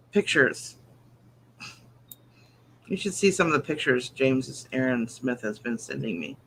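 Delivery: ordinary delivery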